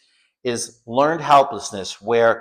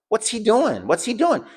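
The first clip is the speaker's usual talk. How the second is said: high-pitched, puzzled questioning; alarmed disbelief; 10 semitones higher than usual. Retold or imitated. Watching the car out the window, alarmed disbelief. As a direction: ask it in rising alarm, voice jumping high.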